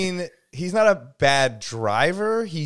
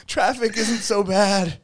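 higher, slow dreamy tone; higher drawn-out stoned musing; mock mellowed voice; 8 semitones higher, slower than usual. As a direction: drift out the words, newly mellow. Imagined stoned friend.

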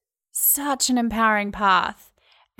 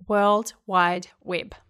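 ordinary delivery

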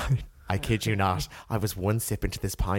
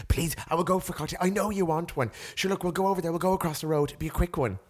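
higher, fast insistent mimic; imitating pushy date; 7 semitones higher than usual. Retelling the date's push for a cocktail, higher wheedling. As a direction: wheedle her into one more drink.

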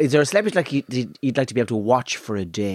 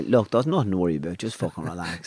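low, soothing and easy; calm, ever softer reassurance; sedative calm; 7 semitones lower, 3 dB quieter than usual. Retold or imitated. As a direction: lull the listener, letting the voice sink away. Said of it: "it's almost like a sedative".

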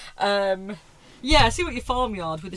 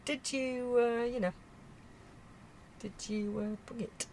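a hint of a fretful posh lady, softer; nervously fussing about her standing, lightly voiced; worried posh lady; 6 semitones lower, 5 dB quieter than usual. Q P fretting about the neighbours gossiping, softly posh. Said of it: "I suddenly felt very Penelope Keith".

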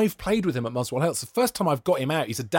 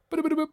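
high-pitched little boops for a bouncing ball; imitated rolling ball; 13 semitones higher, much faster than usual. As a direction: boop it along like a ball dropping in.

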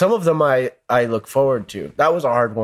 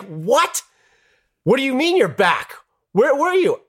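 stunned disbelief. Retold or imitated. Shocked at friend returning.

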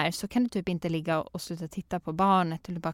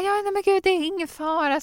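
much higher reassuring coo; calm the crying kid in a high voice; soothing; 12 semitones higher than usual. Retold or imitated. Mother reassuring crying toddler, high and soothing.

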